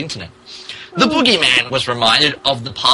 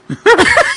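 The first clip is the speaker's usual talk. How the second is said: high-pitched shriek of laughter; helpless squeal of laughter; 7 dB louder than usual. Real, not acted. Cracking up at the text.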